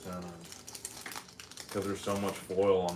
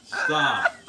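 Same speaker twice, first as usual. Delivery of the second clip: loud laughing plea; 9 semitones higher, 10 dB louder than usual. Real, not acted. Laughing partner begging to stop.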